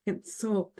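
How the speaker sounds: ordinary delivery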